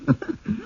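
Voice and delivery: quick high chuckle, quietly; a light amused laugh at the spread; short laugh. Real, not acted. [name] amused by the huge lunch, laughing softly.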